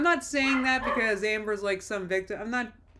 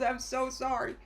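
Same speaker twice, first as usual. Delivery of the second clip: normal talking voice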